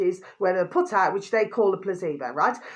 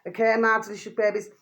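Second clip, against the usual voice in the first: normal talking voice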